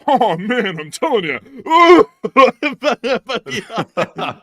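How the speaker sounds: loud, high put-on stand-up whine; hammed-up complaining comedian voice; no-respect comedian bit. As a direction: ham up the put-upon comic. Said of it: "I get no respect around here".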